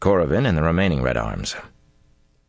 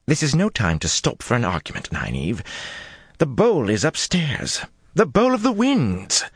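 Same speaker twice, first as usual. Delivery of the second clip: urgent; 9 semitones higher than usual. Acted. Urgent plea to hurry.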